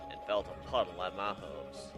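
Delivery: ordinary delivery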